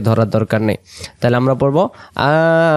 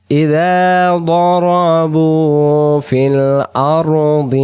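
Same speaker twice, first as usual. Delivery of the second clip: Quran recitation; 3 semitones higher, much slower than usual. Acted. Teacher reciting the verse to the class. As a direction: sing the verse out slowly.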